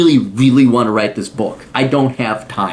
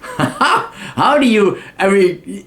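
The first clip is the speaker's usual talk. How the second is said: laughing through the words, pitch jumping up, very slow; amused laugh breaking the question; 5 semitones higher, much slower than usual. Real, not acted. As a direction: chuckle it out, drawn out. Laughs at his own point.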